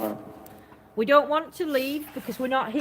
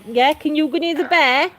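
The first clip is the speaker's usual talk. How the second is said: calling from far away; 3 semitones higher, 7 dB louder than usual. Real, not acted. Calling across the room.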